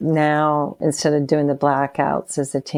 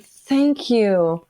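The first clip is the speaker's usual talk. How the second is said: overwhelmed thanks; 7 semitones higher, 3 dB louder, slower than usual. Retold or imitated. Retold thanks to the Lord.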